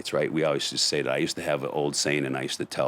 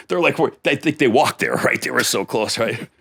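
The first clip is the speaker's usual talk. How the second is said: words bubbling with real laughter, pitch jumping high; laughing while telling; 10 semitones higher, 6 dB louder than usual. Real, not acted. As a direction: laugh through it as you tell the joke. Laughing at cops' timing.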